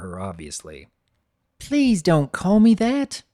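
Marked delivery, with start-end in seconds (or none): 1.6-3.2 high, slow filly voice, fed up; annoyed filly. Acted. Filly annoyed at her nickname, high and slow. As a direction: drag it out like an exasperated little girl. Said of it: "Apple Bloom ... responds with a roll of her eyes".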